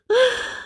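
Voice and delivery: put-on short high child giggle; quick amused giggle. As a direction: laugh at your brother backing away. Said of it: "Ocean laughed".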